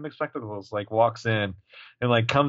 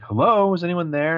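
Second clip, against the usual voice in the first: high impatient hello; 8 semitones higher, 4 dB louder than usual. Retold or imitated. Hannah on hold on phone.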